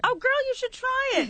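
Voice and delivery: high, loud teasing push. Pretend. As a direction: tease her playfully.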